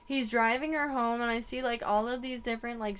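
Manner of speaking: ordinary delivery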